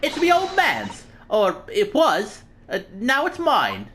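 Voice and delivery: high, slow, drawn-out cheeky urchin; defiant street girl. Acted. Street girl claiming the old man's coat, brazenly defiant. Reads Gina's lines in game.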